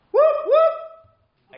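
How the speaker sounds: very high, loud whooping cry; whoop shout. Shown as a demonstration. Vicar showing the children what to shout, a loud high whoop. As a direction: whoop it out like a cheer. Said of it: "what I want you to shout out is".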